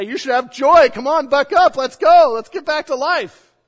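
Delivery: loud, high, pushy buck-up rally; mock pep-talk shout. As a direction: bark it at a grieving friend like a coach who doesn't get it. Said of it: "that's not necessarily the time to say".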